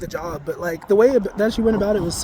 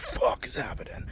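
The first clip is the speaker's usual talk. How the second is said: breathy, dazed mock panic, slowed down; a confused inner cry to yourself, drawn out; bewildered inner voice; 6 semitones higher, 6 dB quieter than usual. Losing the plot mid-journey, hushed and bewildered. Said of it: "you'll be like".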